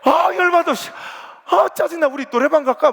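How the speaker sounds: high, loud, exasperated venting; fuming outburst. Retold or imitated. Deaconess venting after the fight, high and loud. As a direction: blow off steam, then suggest karaoke. Church women quarrel over cooking.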